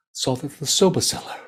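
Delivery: ordinary delivery, nothing marked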